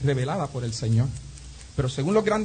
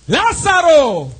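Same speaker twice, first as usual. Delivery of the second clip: loud, high, long-drawn call; great-voice shout; 11 semitones higher, 11 dB louder, much slower than usual. Acted. Preacher enacts Jesus calling the dead man from the tomb, shouting. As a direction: bellow the name, stretching it out. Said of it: "dice que a gran voz gritó".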